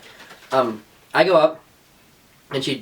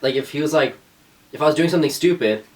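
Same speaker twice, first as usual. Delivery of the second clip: ordinary talk, nothing marked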